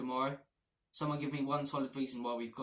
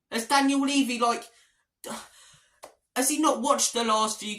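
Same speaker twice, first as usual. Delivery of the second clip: angry rant; 9 semitones higher, 9 dB louder than usual. Real, not acted. Fan ranting about the club's transfer choices.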